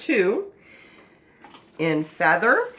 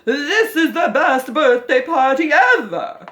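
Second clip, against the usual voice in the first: loud, high, thrilled birthday cheer; excited exclamation; 8 semitones higher, 9 dB louder than usual. Shown as a demonstration. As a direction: belt it out with huge excitement. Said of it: "because it has an exclamation point".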